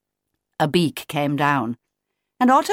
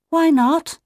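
put-on high child's challenge; questioning chick; 11 semitones higher, 4 dB louder than usual. A narrator's reading. Otto told he won't fall off, piping question. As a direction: pipe up with a quick question.

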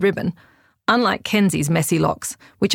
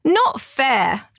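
moaning; 7 semitones higher than usual. A narrator's reading. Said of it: "Dante moaned".

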